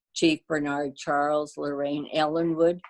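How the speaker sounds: usual speaking voice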